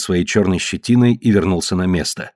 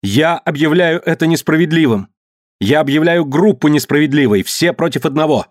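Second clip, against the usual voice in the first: higher-pitched, indignant declaration; crying out in protest; 6 semitones higher than usual. A narrator's reading. Mary Lee defends Dikar before the group, raised protest. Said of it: "Кричала Мэри Ли".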